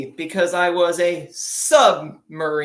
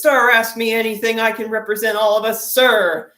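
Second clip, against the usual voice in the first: higher and louder, bold eager recruit; cocky young seaman; 6 semitones higher, 6 dB louder than usual. Retold or imitated. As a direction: answer your superior with brash confidence. Seaman answers confidently.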